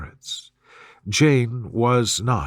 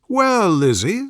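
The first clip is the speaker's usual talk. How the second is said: higher, fretful matron voice; fussy mother; 7 semitones higher, slower than usual. Acted. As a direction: play the gossiping mother, higher. Mrs Bennet voiced in dialogue.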